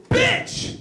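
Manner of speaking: high, sudden yelp of abuse; sharp high-pitched outburst; retold shout. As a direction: blurt it high, as if just hit. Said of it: "and I shouted".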